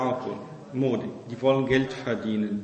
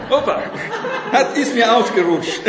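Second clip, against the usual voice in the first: high, loud words through laughter; real belly laugh breaking round the words; 5 semitones higher, 4 dB louder than usual. Real, not acted. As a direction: crack up at your own slip. Jokes about his slip, laughing.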